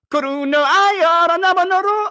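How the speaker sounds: high, loud fake Japanese gibberish; loud, high mock Japanese anime voice. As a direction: imitate anime dialogue from the next room. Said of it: "all I hear is".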